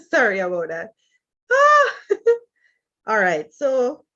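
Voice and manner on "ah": startled cry. Real, not acted. Startled outburst during live session.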